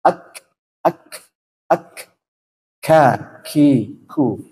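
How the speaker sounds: "Ak" released in a light hiss of air; kaf with hams. Shown as a demonstration. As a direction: end "Ak" with friction, then aspirate "ka, ki, ku". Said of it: "dia akan bergesek".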